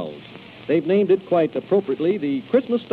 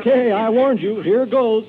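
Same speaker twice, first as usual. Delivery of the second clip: louder, higher mock warning; playful warning; 8 semitones higher, 5 dB louder, slower than usual. Disney teasing Donald before calling the boogeymen, playful warning. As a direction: tease the duck with the threat.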